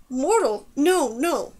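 mild, emphatic protest. A narrator's reading. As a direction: object in disbelief, each word swooping down. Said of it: "The protest came from Tano".

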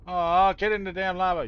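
frustrated yell, then muttered insult; angry yell. Real, not acted. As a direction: shout it at the mob in frustration. Yelling at a mob.

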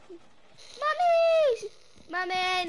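high, loud cry for Mommy; calling for mommy. Pretend. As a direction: scream for mom, high-pitched. Calling mom, being attacked.

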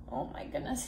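ordinary delivery, nothing marked

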